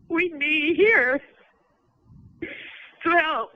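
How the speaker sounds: high, halting, tearful plea; real, choked-up emotion. Real, not acted. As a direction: struggle to get the words out. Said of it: "I'm just emotional".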